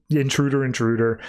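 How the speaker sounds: plain reading voice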